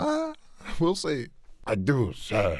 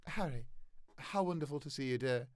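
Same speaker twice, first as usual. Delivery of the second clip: sweet mother; 15 dB quieter, faster than usual. Acted. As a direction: switch to sweetness for Harry. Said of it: "Molly Weasley".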